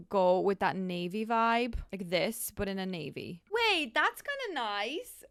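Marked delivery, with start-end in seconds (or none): 3.5-5.2 higher, slow surprise; a hint of delighted surprise; pleasantly surprised. Real, not acted. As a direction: catch yourself liking it. Surprised by own design.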